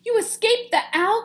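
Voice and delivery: high, loud joyful cry; excited cry. Acted. Stellaluna finding her mother, high excited cry. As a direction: cry out in delight. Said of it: "cried Stellaluna".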